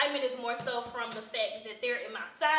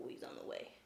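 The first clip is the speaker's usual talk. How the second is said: whisper; 14 dB quieter than usual. Real, not acted. Aside under breath.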